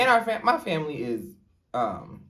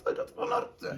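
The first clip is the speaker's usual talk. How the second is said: high soft mock innocent; 9 dB quieter than usual. Retold feigned confusion.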